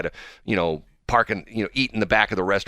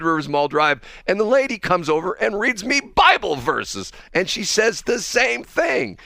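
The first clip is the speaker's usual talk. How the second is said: high, raised-voice bewilderment, hammering each phrase; exasperated rant; 10 semitones higher, 3 dB louder than usual. Real, not acted. Host griping to co-host about women at Freddy's, exasperated. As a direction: vent in comic disbelief, higher and insistent.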